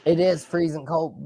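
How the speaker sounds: usual speaking voice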